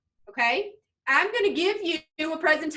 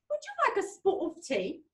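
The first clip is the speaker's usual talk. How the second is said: higher-pitched mock British accent; 5 semitones higher, 4 dB quieter than usual. Mom asks about tea to make kids laugh, British accent. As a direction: put on mom's playful British accent. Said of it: "in a British accent".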